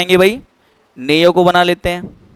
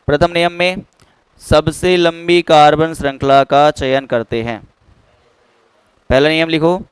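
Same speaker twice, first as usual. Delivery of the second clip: dictation; 4 semitones lower, 3 dB quieter than usual. Real, not acted. Dictates the rule for copying.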